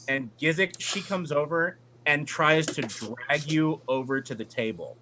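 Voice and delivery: ordinary talk, nothing marked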